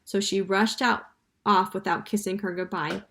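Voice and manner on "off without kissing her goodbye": usual speaking voice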